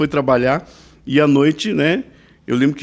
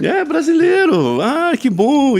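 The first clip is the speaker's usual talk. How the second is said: high, stretched-out delight; hammed-up happy exclamations; excited greeting; 11 semitones higher, much slower than usual. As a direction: imitate someone thrilled to meet a compatriot. Retold meeting of fellow Brazilians.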